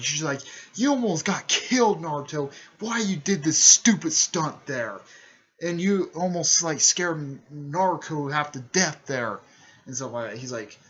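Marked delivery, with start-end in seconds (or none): 0.7-9.4 loud, raised-pitch telling-off; hard scolding. Retold or imitated. Older sister to Naruto after his stunt, imitated scolding. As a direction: imitate someone chewing out a reckless kid. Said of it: "was scolding Naruto pretty hard".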